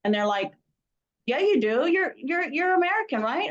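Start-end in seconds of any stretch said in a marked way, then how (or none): 1.3-3.5 high, mock-insistent voice of the Germans. Mimicking German guys insisting he speaks English, high and mocking. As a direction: squeak it up like pushy locals.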